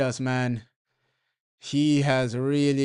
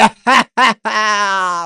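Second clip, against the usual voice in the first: excited laugh; 6 semitones higher, 8 dB louder than usual. Real, not acted. Reacts to the match.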